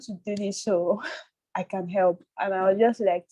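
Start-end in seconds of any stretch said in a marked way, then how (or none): none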